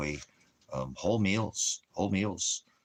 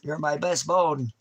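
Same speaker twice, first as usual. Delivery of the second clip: higher doting pet voice; 4 semitones higher than usual. Real, not acted. Owner gushing praise at his dog in baby talk.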